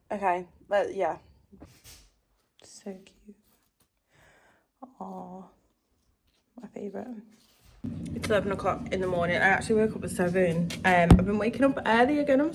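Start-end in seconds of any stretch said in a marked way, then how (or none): 2.6-7.1 whisper. Real, not acted. Admiring something small.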